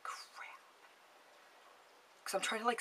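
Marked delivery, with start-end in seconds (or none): 0.0-0.5 barely voiced whispered aside; whispered dismay. Caller dreading Robert's question, whispered aside. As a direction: whisper it to yourself, caught out.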